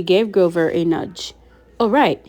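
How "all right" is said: louder, higher agreement; chiming in. A narrator's reading. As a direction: chime in with a lifted, louder voice. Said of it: "he chimed in".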